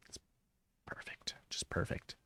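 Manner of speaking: hushed breathy whisper on each word. Pretend. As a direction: whisper it like a secret. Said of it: "I tried to do a bit there".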